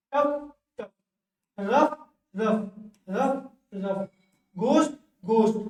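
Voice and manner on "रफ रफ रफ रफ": रफ stretched out very slowly each time; slow drill. Shown as a demonstration. Teacher drilling students on रफ, very slowly. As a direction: draw out रफ slowly for copying. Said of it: "teacher drills the word rough".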